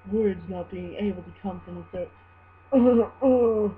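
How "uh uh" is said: stuttering. Acted. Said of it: "he stuttered".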